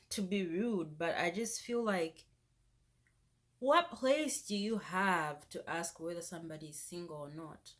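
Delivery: ordinary talk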